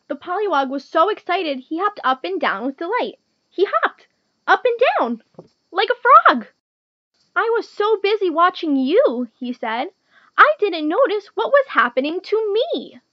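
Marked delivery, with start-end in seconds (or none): 0.1-6.5 high, bouncing storytelling with a loud finish; excited narration. A narrator's reading. Narrating the frog's delighted hopping. 7.3-9.3 high, louder amazed frog voice; excited frog. A narrator's reading. Delighted new frog speaks. 10.4-13.0 high, loud amazement; excited frog. A narrator's reading. Delighted new frog speaks.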